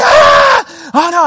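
loud, high-pitched acted scream; panicked scream. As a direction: shriek in alarm at a swerving car. Re-enacting panic at the wheel.

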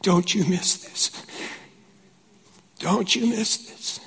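hushed, breathy-soft whisper of God's urging; whispered voice of the Lord. Retold or imitated. Pastor retelling God's whisper to him. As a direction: whisper it like a holy prompting. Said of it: "I heard the Lord say".

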